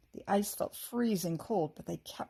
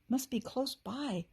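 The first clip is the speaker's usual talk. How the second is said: scared gasp; 5 semitones higher, slower than usual. A narrator's reading. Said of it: "Kirsty gasped, looking scared".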